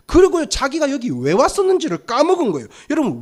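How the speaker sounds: usual speaking voice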